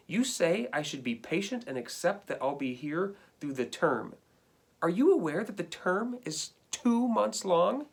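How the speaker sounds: lively read-aloud of a dog's complaining letter; reading to children. Acted. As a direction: read it to the class like a hard-done-by pet. Teacher reads dog's letters aloud.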